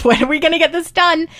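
high-pitched retold pestering; impatient. Patient pressing midwife to finish stitching, impatient. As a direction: act out wanting it over with.

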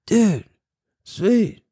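stoner movie voice. Retold or imitated. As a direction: play the clueless movie dude.

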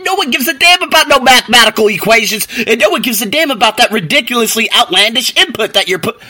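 high-pitched, exasperated outburst; exasperated rant. Real, not acted. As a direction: cut the nitpicker off, fed up and high. Exasperated rant at online know-it-all commenter.

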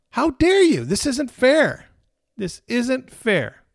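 high, slow indignant protest; older brother protesting to his father, high and slow; outraged whine. Retold or imitated. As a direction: stretch it out like a wronged sibling.

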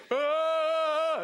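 high, sustained comic wail; mock scream. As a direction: wail as if dangling from ropes.

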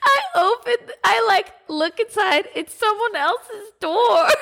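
high, squealing near-tearful laughter; laughing punchline. Real, not acted. Confessing the wrong door to friend, high laughing squeal. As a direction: squeak it out while cracking up, slowing at the end.

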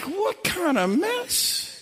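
high, slow, stunned question; amazed disbelief. Retold or imitated. Preacher marvelling at the pastor's quiet power, slow and high. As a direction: draw it out in disbelief. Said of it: "I'm like".

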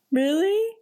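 high incredulous question; doubtful really. Retold or imitated. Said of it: "she looks at me and she goes".

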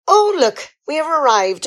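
sudden loud, high "oh look"; mock surprise. Announcing the instant arrival at the fair, mock surprised. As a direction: fake astonishment at arriving.

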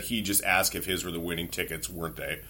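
low, hushed deadpan; deadpan aside. Retold or imitated. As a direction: murmur it low, aside to the clerk. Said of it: "I just slowly turned back to her and said".

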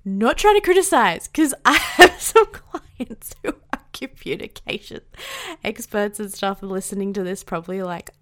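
normal talking voice

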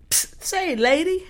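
high sly hiss of a call, rising at the end; sly street call. Retold or imitated. Stranger hissing at her from the stands. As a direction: call her over like a hustler. Man accosts her in arena.

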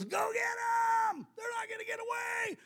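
hammed-up command to give chase, stretched out high; Pharaoh. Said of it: "and Pharaoh goes".

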